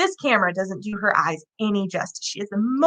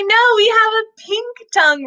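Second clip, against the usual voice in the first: voicing the kids; 10 semitones higher than usual.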